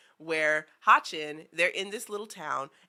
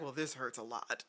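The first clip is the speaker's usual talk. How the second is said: quiet hurt; 6 semitones lower, 11 dB quieter than usual. Retold or imitated. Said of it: "she's just like".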